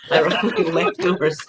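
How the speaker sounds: amused, laughing through the words. Real, not acted. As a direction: say it through a laugh at your own list. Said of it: "I'm laughing because".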